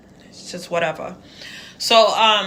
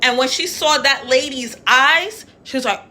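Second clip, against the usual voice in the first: ordinary talk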